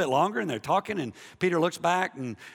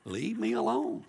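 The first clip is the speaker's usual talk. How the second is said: mock-exasperated, higher and softer; half-laughing, put-upon protest; fed-up spouse; 5 semitones higher, 4 dB quieter than usual. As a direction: whine it like a pestered spouse. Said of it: "it just kinda gets old after a while".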